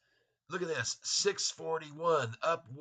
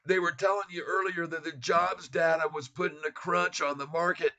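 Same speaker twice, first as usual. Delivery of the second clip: ordinary talk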